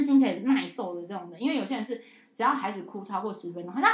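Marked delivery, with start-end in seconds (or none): none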